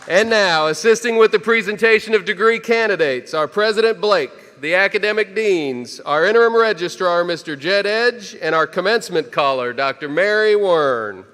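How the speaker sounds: loud, high, projected introductions; ceremonial announcer. Real, not acted. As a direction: announce the dignitaries to the whole hall, drawing out the names. Formal ceremonial announcement of platform party.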